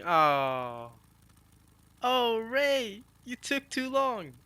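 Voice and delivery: high, drawn-out tearful whine; mock crying. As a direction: imitate a woman weeping with relief. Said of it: "And she's crying".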